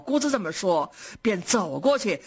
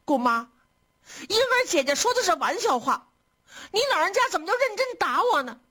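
high-pitched, upset pleading; acted tearful appeal to an aunt; tearful protest; 8 semitones higher than usual. A narrator's reading. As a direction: plead high and fast, as if close to tears. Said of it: "就哭着说".

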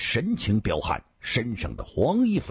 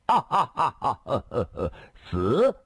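high burst of laughter, tailing off; sudden loud laugh; 6 semitones higher than usual. A narrator's reading. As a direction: throw out a wild laugh from nowhere. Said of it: "突然仰面大笑". (突然仰面大笑)

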